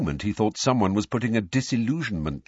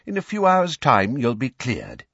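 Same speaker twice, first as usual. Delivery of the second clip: louder, higher encouragement; raised, bracing reassurance; 6 semitones higher, 5 dB louder than usual. A narrator's reading. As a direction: say it firmly to buck someone up. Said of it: "Mister Weasley said bracingly".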